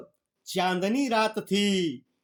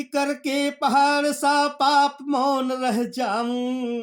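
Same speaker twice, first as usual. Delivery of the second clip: slow, sung-out poem line; slow chanted poetry reading; verse recitation; 3 semitones higher, slower than usual. Acted.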